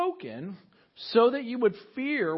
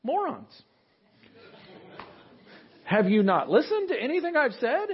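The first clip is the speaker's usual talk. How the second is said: exasperated God; 6 semitones higher, 4 dB louder than usual. Acted. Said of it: "God goes".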